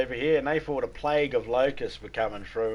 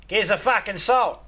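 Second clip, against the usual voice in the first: slow, higher, louder bratty demand; rude kid; 8 semitones higher, 6 dB louder than usual. Acted. Johnny rudely asking for salt at the table, bratty. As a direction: slam the line down like a rude kid.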